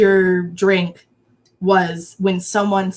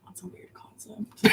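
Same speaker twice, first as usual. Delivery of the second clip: whispering; 13 dB quieter than usual. Real, not acted. Mocking disgust at crush talk.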